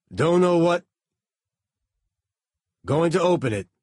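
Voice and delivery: loud, high shout over noise; loud, urgent report over static; over the radio. A narrator's reading.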